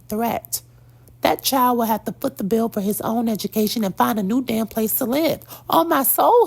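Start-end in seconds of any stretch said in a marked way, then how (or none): 5.7-6.5 loud, high solemn vow; emphatic oath. Real, not acted. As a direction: swear it hard and loud. Swearing she'd cut off her son.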